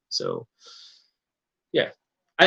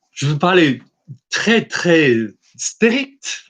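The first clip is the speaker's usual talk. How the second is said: halting, higher French with drawn-out words; careful, effortful French accent; 4 semitones higher, much slower than usual. Shown as a demonstration. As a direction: reach for strict French, slowly. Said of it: "Same thing in my French too".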